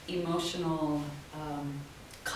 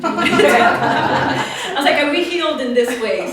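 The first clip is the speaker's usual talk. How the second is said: laughing; 7 semitones higher, 13 dB louder, slower than usual. Real, not acted. Laughing at therapy joke.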